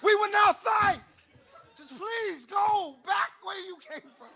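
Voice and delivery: high, loud pleading promise not to fight; pleading sons. Retold or imitated. Sons pleading with their mom to leave. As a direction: beg her to go back. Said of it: "We like".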